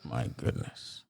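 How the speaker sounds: barely voiced, slow, stunned; hushed shock. Real, not acted. Host reacting to a guest's son killed, hushed real shock. Host hears of son's death.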